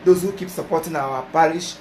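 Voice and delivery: usual speaking voice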